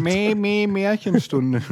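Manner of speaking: high goat-like jingle; playful high-pitched catchphrase; bleated slogan. Pretend. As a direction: bleat the show's name like a goat. Bleats the proposed show slogan.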